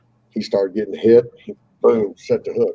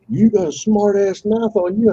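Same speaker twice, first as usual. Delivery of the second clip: higher put-on voice for the other guy's jab; mock rival taunt; 11 semitones higher than usual. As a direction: imitate the rival sneering at him. Quoting the rival fisherman.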